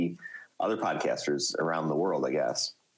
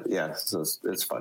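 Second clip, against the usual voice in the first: normal talking voice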